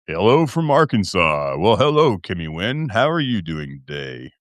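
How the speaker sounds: low, smooth host greeting; put-on deep voice. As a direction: let the greeting sink deep. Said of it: "See, I broke it again".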